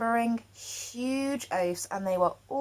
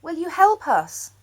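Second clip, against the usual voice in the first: pleading question; 6 semitones higher, 4 dB louder than usual. A narrator's reading. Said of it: "they asked".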